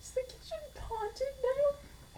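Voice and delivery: high wavering ghostly moan; slow, high haunted wail. Pretend. Joking about a haunted kitchen.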